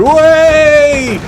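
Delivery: very high, loud, held whoop; long jeering British cheer; imagined crowd cheer. Acted. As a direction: cheer like a whole stadium at a blunder. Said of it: "I just imagine everyone going".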